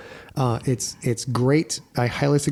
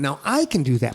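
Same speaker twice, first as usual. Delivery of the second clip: ordinary talk, nothing marked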